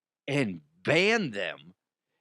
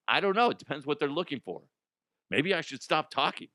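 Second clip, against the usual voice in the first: ordinary delivery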